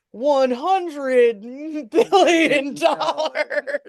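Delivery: hammed-up movie villain, stretching the huge sum through laughter; Doctor Evil. Joking to co-host about a villain's ransom, hammed-up villain impression. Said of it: "I feel like Doctor Evil".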